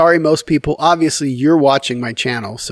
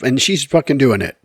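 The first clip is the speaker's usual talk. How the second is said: ordinary talk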